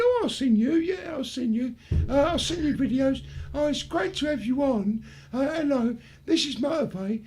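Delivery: starstruck. Retold or imitated. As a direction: gush a little at the guest. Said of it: "A little bit starstruck".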